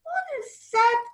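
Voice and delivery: put-on high startled child; startled question. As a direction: jump at the noise. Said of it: "Kim asked".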